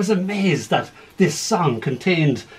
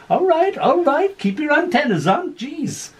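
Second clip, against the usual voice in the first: much higher, teasing yokel officer; customs officer voice; 12 semitones higher than usual. Acted. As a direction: brush him off like a smug border guard. Martian customs officer mocking.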